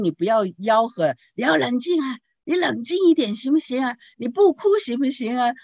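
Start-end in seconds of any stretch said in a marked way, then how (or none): 1.4-5.5 high, exasperated scolding; higher-pitched impatient yell. Shown as a demonstration. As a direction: shout at a crying child to calm down. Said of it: "我们是这样吆喝".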